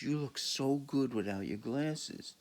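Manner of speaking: high, slow syrupy compliment; gushing. Retold or imitated. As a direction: coo it up high like a flatterer. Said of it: "she goes".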